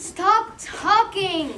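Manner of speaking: very slow, drawn-out angry command; exasperated order. Acted. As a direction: stretch each word out in fury. Boss fed up with babbling.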